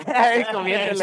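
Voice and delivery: slow, laughing mock of the bandmate's drawn-out comiénsele; imitating his voice. Friend mocking the bandmate's voice while laughing. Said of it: "Su voz ese".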